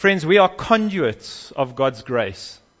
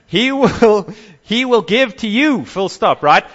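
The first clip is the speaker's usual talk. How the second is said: mock American accent; 8 semitones higher, 8 dB louder than usual. Preacher mocking prosperity teachers, in an over-the-top American accent. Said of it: "in an American accent".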